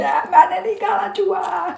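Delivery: high, loud mock wail of a small girl; imitated child wail. Retelling her childhood tantrum to viewers, high loud mock wail. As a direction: cry out like a kid refusing the rat. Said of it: "मैं बड़ा करलाई जोर जोर से".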